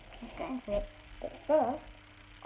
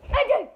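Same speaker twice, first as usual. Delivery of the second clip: high loud hammed-up sneeze; loud sneeze on cue; loud fake sneeze; 10 semitones higher, 6 dB louder than usual. Shown as a demonstration. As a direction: blast out a funny sneeze. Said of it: "The loud one".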